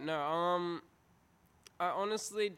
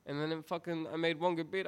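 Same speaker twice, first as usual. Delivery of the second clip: normal talking voice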